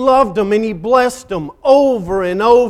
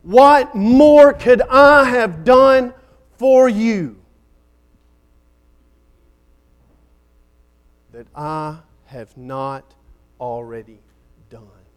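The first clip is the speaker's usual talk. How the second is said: loud slow plea on "What more", then sinking to a hush on "done"; pleading cry fading; 4 dB louder, slower than usual. Acted. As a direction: cry out slowly, then let it die away. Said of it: "God cries out from heaven today".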